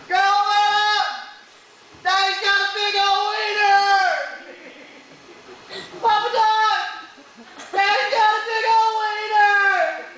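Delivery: put-on high-pitched kid yelling out the news; shouting little boy. Son running out to tell Grandma, high childish shout. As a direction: holler it like an excited small child, words stretched.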